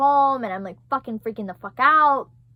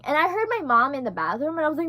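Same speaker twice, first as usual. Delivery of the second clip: ordinary talk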